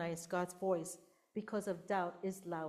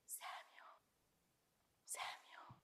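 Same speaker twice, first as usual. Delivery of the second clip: whispered call, voiceless and very soft; 20 dB quieter, slower than usual. Acted. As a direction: whisper the name gently, like God calling in the night. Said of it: "He talks very softly".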